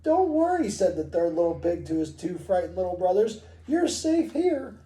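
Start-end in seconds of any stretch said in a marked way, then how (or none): 0.0-0.7 very high little pig voice; reassuring piglet. Acted. Third little pig to his frightened brothers, reassuring piglet. 3.7-4.7 very high little pig voice; reassuring piglet. Acted. Third little pig to his frightened brothers, reassuring piglet.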